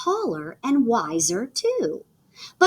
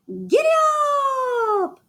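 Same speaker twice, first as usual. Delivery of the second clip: shouted giddy up, riding hard. Acted. Cowgirl racing her bike downhill, shouting. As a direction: yell it as you race downhill.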